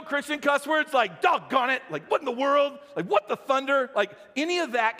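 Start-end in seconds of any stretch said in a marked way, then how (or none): 1.2-1.8 higher, louder comic exasperation; mock cussing. 2.1-2.8 high-pitched comic exasperation; mock cussing. 3.1-3.9 high-pitched mock outrage; mock cussing.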